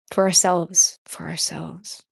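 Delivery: low, hushed repeat; low aside. Pretend. Repeats phrase, dropping away, partner whispers it.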